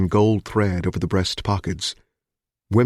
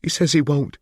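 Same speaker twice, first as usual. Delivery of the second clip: frightened; 7 semitones higher than usual. Acted. Hostage relays refusal.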